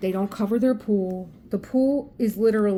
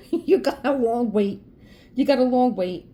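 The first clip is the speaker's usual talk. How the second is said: taunting; 4 semitones higher, 4 dB louder than usual. Retold or imitated. Taunting the son.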